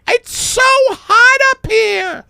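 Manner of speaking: very high, drawn-out loud whine; hammed-up falsetto misery; wailing complaint. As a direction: wail as if roasting under lights. [name] wails under the lights.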